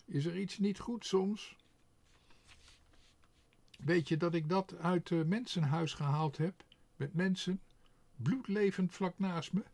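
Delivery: offended crow. A narrator's reading. Quark protests to Tos about the money, high indignant crow voice. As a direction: protest as a hurt proud crow.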